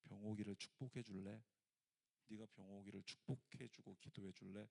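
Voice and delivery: soft, low asking voice of the Lord; gentle request. Retold or imitated. The Lord asking the sister to bless him, gentle and soft. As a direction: ask it tenderly and quietly. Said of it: "그 음성을 들려주셨대요".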